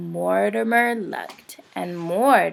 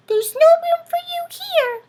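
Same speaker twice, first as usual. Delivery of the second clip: much higher, louder squeaky mouse; tiny mouse being firm; squeaky mouse voice, stern little push; 8 dB louder than usual. A narrator's reading. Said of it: "Mortimer said".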